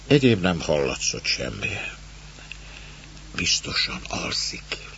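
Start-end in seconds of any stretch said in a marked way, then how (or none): 3.4-4.9 slow, hushed whisper; voiceless, soft and unhurried. A narrator's reading. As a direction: whisper it so the sleeper won't hear. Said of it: "súgta az asszony".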